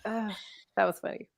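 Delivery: breathless after laughing; out of breath from laughing; catching my breath. Real, not acted. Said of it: "I'm catching my breath now".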